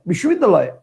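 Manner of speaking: বিশ্ববিদ্যালয় run together without a break; all in one go. Shown as a demonstration. Teacher shows students the last pronunciation, বিশ্ববিদ্যালয় all at once. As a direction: say বিশ্ববিদ্যালয় as one unbroken word. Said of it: "আবার একবারে".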